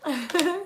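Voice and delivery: high, ringing "bing"; imitated ding. Shown as a demonstration. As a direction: sing out a little chime sound. Imitated chime after naming card.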